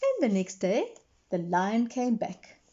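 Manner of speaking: usual speaking voice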